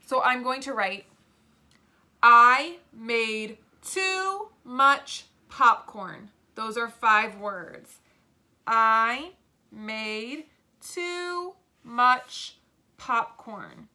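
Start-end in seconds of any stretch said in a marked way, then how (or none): 2.2-6.3 very slow, loud, separated words; word-by-word dictation. Shown as a demonstration. 8.7-13.9 very slow, each word held apart; word-by-word dictation. Shown as a demonstration.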